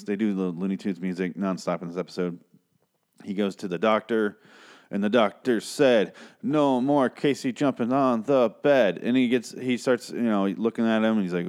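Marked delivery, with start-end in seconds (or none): 6.4-9.0 slow, higher, measured mock verdict; nursery-rhyme recital. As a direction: recite it like a children's rhyme, word by word. Doctor quote parodies nursery rhyme.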